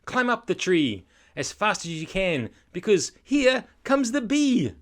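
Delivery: rising to a high, loud alarm; urgent warning. Acted. Terry sees the bee coming, building alarm. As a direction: build to a panicked climax. Terry urges escape up tree.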